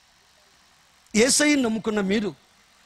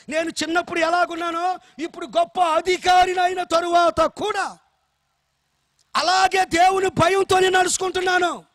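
full-force preaching; 6 semitones higher than usual. Acted. Pastor preaching hard to the congregation about Joseph, ringing and raised. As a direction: preach it out to the whole hall.

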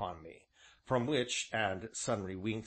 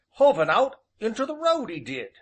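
put-on high old countryman's voice; old rustic innkeeper; 11 semitones higher, 9 dB louder than usual. A narrator's reading. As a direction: voice the old villager, folksy and drawn. Ancient's dialect voice.

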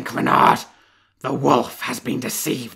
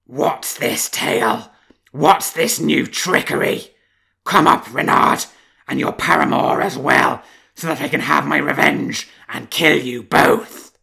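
savage threat; 3 semitones higher, 8 dB louder than usual. Acted. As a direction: roar death threats down the well. Wolf rages at fox.

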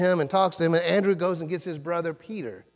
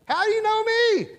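a much higher, put-on voice, a touch louder, acting indignant; proud Nathanael; 6 dB louder than usual. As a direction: play Nathanael as the one who thinks he is famous, startled and a bit proud. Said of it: "No, he goes".

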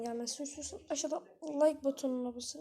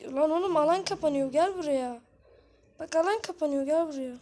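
louder, urgent call to a teammate; calling out loudly; 6 dB louder than usual. Real, not acted. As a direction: yell for him to come back in. Calling teammate as zone closes. (alan kapanırken takım arkadaşına yüksek sesle sesleniyor)

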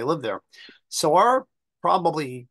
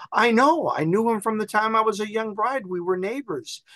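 put-on higher, excited old English lady recalling her neighbor; little old lady; 8 semitones higher than usual. Old lady telling the visitor she knew his ancestor, high and eager. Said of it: "I said".

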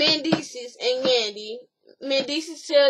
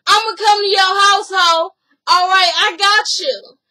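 loud, high and lilting; mock singsong threat; 6 semitones higher, 9 dB louder than usual. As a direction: taunt her with the threat. Acted quarrel, parting threat.

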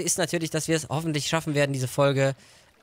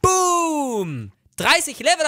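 high triumphant boom; excited shout; 10 semitones higher, 5 dB louder, much slower than usual. Real, not acted. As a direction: yell it out in triumph. Level thirty reached, celebrating.

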